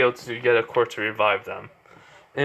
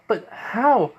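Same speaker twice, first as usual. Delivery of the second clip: astonished question; 10 semitones higher than usual. Acted. Sister amazed at revival.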